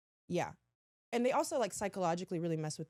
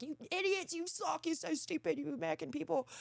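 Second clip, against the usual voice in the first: mock British yelling; 10 semitones higher than usual. Staff berating failed contestants, mock British yell. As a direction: imitate staff berating you in a British accent. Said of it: "they're, like, yelling at us".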